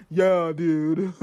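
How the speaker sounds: low put-on dude voice; man voice. Shown as a demonstration. Podcaster showing off her go-to man impersonation, low man voice. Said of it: "That's my man voice".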